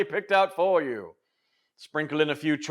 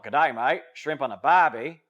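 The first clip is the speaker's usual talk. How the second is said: mock Australian catchphrases; Australian accent; 3 dB louder than usual. Shown as a demonstration. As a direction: toss it off in a broad Australian accent. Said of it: "not sound Australian".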